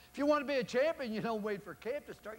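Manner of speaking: high, loud rallying cry; booming exhortation. Real, not acted. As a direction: lift the room on champion. Coach exhorting audience at full force.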